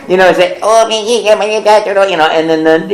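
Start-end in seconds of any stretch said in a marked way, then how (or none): 0.6-2.1 put-on higher, cooing baby babble. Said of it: "there are the babies".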